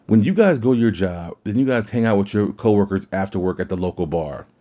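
usual speaking voice

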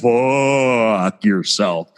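very slow, stretched-out curse; drawn-out insult. Retold or imitated. Co-host tells off the store clerk, curse stretched very slowly. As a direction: stretch the vowel out long, savouring it.

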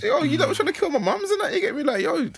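high, outraged complaint; indignant outburst. Retold or imitated. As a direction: voice shooting up in disbelief. Indignant imagined complaint in jail.

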